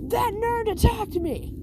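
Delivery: high, slow whiny accusation; tattling bully. Retold or imitated. Bakugo tattling to the teacher, high whiny voice. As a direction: whine it out like a tattletale.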